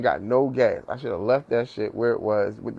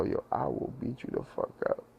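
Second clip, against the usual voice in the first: whispered threat, much softer; low tone; 11 dB quieter than usual. Shown as a demonstration. Pulling someone aside to warn them, whispered threat. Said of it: "This is the time that I use the low tone".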